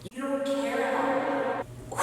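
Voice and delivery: soft, slow, breathy mumble; drugged customer. Retold or imitated. Drugged man accusing the clerk, soft dazed mumble. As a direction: murmur it hurt and dazed. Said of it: "And he's like".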